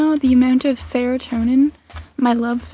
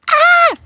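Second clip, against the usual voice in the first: excited squeal; 6 dB louder than usual. Real, not acted. Another cat spotted.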